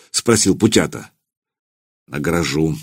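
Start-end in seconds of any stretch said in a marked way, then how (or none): 2.1-2.8 low, soft, dry and curt. A narrator's reading. Dobrynya answers Putyata dryly, soft and curt. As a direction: say it dryly, no emotion. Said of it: "сухо произнёс Добрыня".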